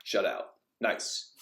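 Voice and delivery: very soft, low inner thought, mildly pleased; a quiet aside said to himself. Retold or imitated. Goalkeeper thinking to himself after the match, softly pleased. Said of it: "in my head, I went".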